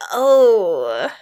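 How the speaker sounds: wincing. Acted. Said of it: "Roxy says with a wince".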